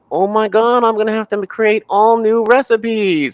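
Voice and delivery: high, slow, dramatic "oh my God" panic; hammed-up horrified realization, drawn out; mock panic. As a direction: act out the moment it all clicks, wide-eyed. Panicked realization about new recipes.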